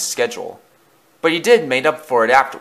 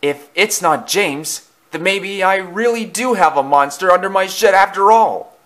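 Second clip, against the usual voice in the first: worried thought, climbing higher; Thomas; 7 semitones higher than usual. A narrator's reading. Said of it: "thought Thomas".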